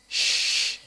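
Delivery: a soft hushing "shh", lips slightly moving; contoh bunyi shin. Shown as a demonstration. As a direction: demonstrate a rounded "shh" sound. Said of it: "ada orang yang shinnya". (bunyi "shh" lirih dengan bibir sedikit bergerak)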